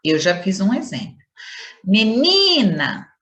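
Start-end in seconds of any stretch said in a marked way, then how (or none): 1.8-3.1 "Menina" slow, with NI long, loud and forceful; stress on NI. Shown as a demonstration. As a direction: punch and stretch the middle syllable of "Menina". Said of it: "o ni é mais forte". ("Menina" com o NI forte e alongado)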